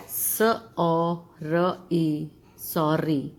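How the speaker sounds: स ओ र ई drawn out letter by letter; spelling slowly. Shown as a demonstration. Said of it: "next जो word है". (अक्षर अक्षर धीरे बोलना)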